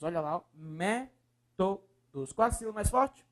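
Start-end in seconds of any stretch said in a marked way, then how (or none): 0.5-2.3 "Métodos" broken into slow, separate syllables. Shown as a demonstration. Teacher splitting the word for the class, syllable by syllable. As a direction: space out each syllable of "Métodos".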